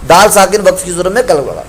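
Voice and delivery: ordinary talk, nothing marked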